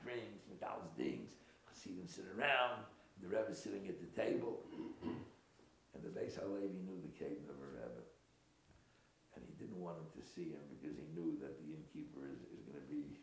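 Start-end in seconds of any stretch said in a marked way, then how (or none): none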